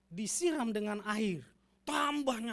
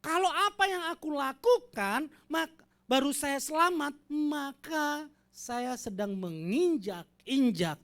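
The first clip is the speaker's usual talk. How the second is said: preaching at full force; 8 semitones higher, 9 dB louder than usual. Acted. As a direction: build the sermon to a rousing peak. Preaching climax.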